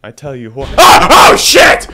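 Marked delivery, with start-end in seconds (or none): 0.8-1.3 sudden high, loud yelp of fright; startled scream. Real, not acted. Player hit by a jump scare, startled scream. 1.3-1.9 very high, very loud shriek of shock; startled shout. Real, not acted. Player reacting to a jump scare, startled shout.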